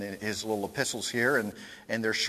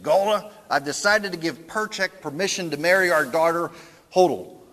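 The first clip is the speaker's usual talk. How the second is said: louder, blustery announcing husband; Tevye impression; 5 semitones higher, 7 dB louder than usual. Tevye announces the marriage to his wife, blustery. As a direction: proclaim it like a proud blustery father. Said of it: "do my best imitation".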